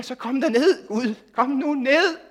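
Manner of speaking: the crowd of prophets on Mount Carmel calling God down, shouting; desperate pleading shout. Retold or imitated. Loud high frantic plea.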